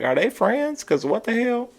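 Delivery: very high-pitched mock bewilderment; incredulous squeak. Host wondering aloud if the quarrelling women are friends, high incredulous squeak. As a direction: squeak it out in a tiny, baffled voice. Voices own baffled reaction to quarrel.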